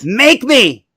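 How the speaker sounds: high, hammed-up challenge; comic shouted defiance; defiant retort. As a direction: dare them in a mock quarrel. Acted quarrel, defiant comeback.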